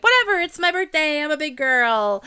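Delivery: put-on high, loud childlike squeal of yay; five-year-old. Birthday girl cheering like a small child, high and loud. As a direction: gush like a little kid on her birthday. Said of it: "turn me into a five-year-old".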